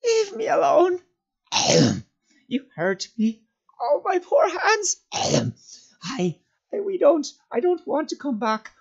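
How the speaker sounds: very high, anguished creature wail; broken, high-pitched creature crying; sobbing in the throat. A narrator's reading. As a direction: sob and gulp through the words. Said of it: "he sobbed in his throat".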